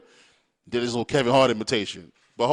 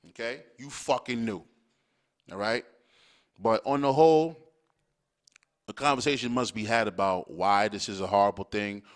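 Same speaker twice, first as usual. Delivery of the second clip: normal talking voice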